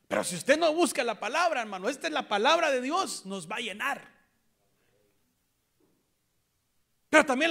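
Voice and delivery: high, loud impassioned preaching; full-force sermon shout; fervent preaching. Real, not acted. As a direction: preach it at the top of your voice.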